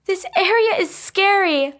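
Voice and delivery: put-on very high, uneasy girl's voice; timid high-pitched character voice; Kat, nervous. A narrator's reading. Kat uneasy in a scary area, high nervous voice. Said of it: "said Kat nervously".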